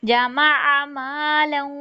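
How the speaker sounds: जमा मालन with short vowels, not drawn; no stretching. Shown as a demonstration. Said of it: "खींचना किसी को भी नहीं है". (जमा मालन बिना खींचे)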